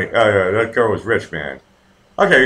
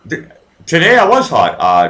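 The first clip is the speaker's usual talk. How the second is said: usual speaking voice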